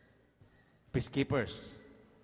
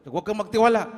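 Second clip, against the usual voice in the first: urgent warning; 6 semitones higher, 8 dB louder, faster than usual. Retold or imitated. Station manager warns him.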